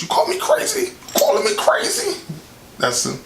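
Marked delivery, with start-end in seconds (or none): 0.0-2.2 very high, squealing mock outrage; hammed-up high-pitched indignation; Marlon Wayans bit. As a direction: shriek it like an offended comedy character. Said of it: "End up like Marlon Wayans".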